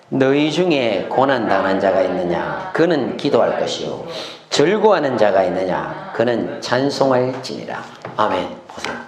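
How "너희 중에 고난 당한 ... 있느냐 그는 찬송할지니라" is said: low, measured recitation; scripture reading. Shown as a demonstration. Preacher leading congregation through a verse, solemn reading. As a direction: read the verse evenly in a lowered voice. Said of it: "십삼 절 같이 읽습니다".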